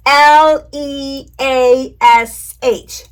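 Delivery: letters spelled very slowly and loudly; slow spelling. Shown as a demonstration. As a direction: spell each letter slow and loud for kids. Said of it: "let's spell it together".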